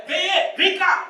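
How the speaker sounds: loud, high shouted call; wife calling out. Retold or imitated. Wife calling Benê again, loud and high. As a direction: shout for the husband to come. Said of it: "Aí".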